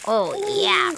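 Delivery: usual speaking voice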